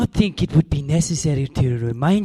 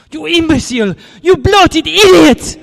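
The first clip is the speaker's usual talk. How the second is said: furious insult; 12 semitones higher, 8 dB louder than usual. Acted. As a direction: spit the insults in rage. Cairo rages at Gutman.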